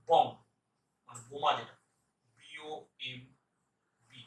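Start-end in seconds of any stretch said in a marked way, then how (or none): none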